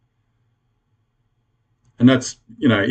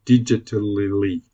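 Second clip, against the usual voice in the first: careful retry; 6 semitones lower, 4 dB quieter, slower than usual. Real, not acted. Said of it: "Sorry, hard to say".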